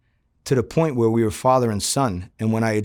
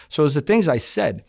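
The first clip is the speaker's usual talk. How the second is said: ordinary talk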